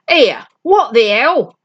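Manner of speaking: a thug stunned by the sudden light; rough startled exclamation. Acted. Skinhead caught by surprise.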